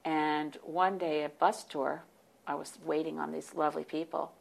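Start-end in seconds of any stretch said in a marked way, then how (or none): none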